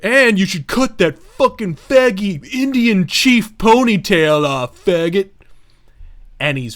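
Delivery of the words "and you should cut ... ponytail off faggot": louder put-on sneering thug; imitated insult in a tough-guy voice; mock taunt. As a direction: jeer it while backing away. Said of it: "He's like".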